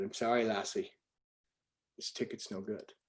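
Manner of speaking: soft imitation of an Irish doorman; lightly put-on Irish accent, quietly regretful; Irish brogue. Irish ticket taker turning a girl away, soft brogue. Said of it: "he's an Irish bro".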